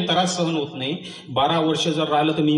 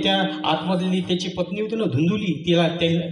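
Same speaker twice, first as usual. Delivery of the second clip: ordinary talk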